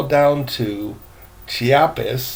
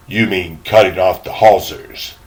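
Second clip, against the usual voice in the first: put-on a fussy, louder old gentleman's voice; proper old gentleman; 5 semitones lower, 6 dB louder than usual. Gentleman asking the boy about his cabin, fussy and formal. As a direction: sound like a prim elderly traveller. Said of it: "said the elderly gentleman".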